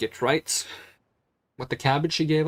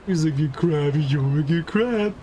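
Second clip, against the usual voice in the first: put-on baby talk to pet; 2 semitones higher, 4 dB louder than usual. Praising pet crab.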